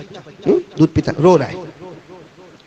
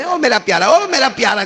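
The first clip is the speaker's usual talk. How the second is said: lightly voiced mother calling her baby from afar; a hint of a loving mother's call, the second one higher; 9 semitones higher than usual. Mother calls her crying baby from afar, lightly voiced. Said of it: "ایسی ماں دور سے ایک آواز دی".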